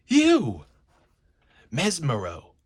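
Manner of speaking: high, loud cry of recognition; shocked outburst. Acted. Beast recognising his enemy Mesmero with a shocked cry. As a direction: burst out on seeing the old foe.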